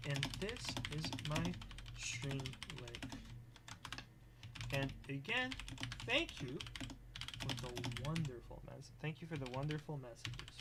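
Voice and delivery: very soft, halting dictation; composing aloud. Real, not acted. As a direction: murmur word by word while writing. Composes thank-you message aloud.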